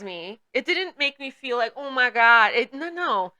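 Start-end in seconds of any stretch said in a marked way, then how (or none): none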